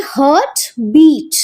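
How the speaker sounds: Heart बीट, both words heavy and even, slowly; equal stress. Shown as a demonstration. Teacher showing students a spondee, both beats stressed. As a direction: hit heart and बीट alike. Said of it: "दोनों को बोलने के लिए हमारा stress".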